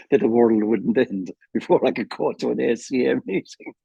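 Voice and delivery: normal talking voice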